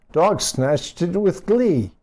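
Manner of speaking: plain reading voice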